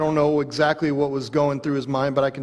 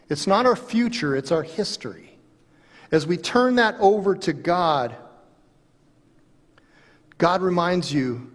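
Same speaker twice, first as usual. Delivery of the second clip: loud, higher preaching climax; 4 semitones higher, 4 dB louder than usual. Acted. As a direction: drive home the hope.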